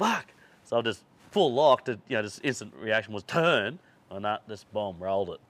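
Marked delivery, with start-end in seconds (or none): 0.0-0.3 high-pitched retold yelp of panic; mock panicked curse; alarmed outburst. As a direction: blurt it like the crash is coming. Acted cry at sudden crash.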